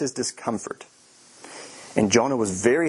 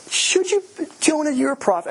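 much higher, louder disbelief; incredulous; 13 semitones higher, 3 dB louder than usual. Real, not acted. Preacher scolding Jonah in disbelief, much higher and louder. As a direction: cry out in astonishment. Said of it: "I mean, number one".

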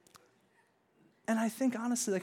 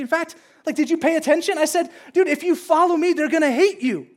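high, loud incredulous scolding; hammed-up exasperated God, insistent and forceful; exasperated rebuke; 9 semitones higher, 9 dB louder than usual. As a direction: set them straight in disbelief.

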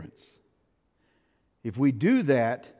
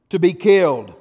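loud preaching; 9 dB louder than usual. Acted. Preacher to congregation, a loud punched line.

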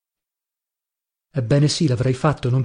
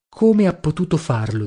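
plain reading voice

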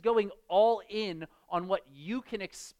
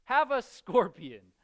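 breezy dad, pitched higher, the scorpion line louder; mock cheerful dad; 7 semitones higher, 4 dB louder, slower than usual. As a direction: cheerfully hand over the scorpion. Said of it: "And the dad says".

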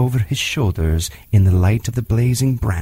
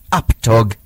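sharp louder command; cried out; 4 dB louder than usual. Acted. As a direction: bark the order at the tiger. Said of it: "Mowgli cried".

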